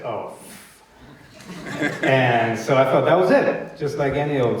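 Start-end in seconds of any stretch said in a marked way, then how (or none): 0.0-0.8 low, hushed cut-off curse; disappointed mutter, trailing away; deflated retold reaction. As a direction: let the swear die under your breath. Reacts to losing the role.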